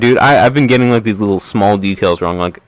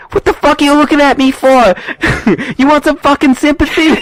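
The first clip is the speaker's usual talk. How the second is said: much higher, laughing mock gangster; Joe Pesci impression. Tommy mocking Spider's cast, high laughing sneer. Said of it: "Joe Pesci's like".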